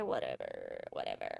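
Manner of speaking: a mock spirit voice from the deep; very low mock prophet murmur; deep mystic voice. Signs from the spider comforting her.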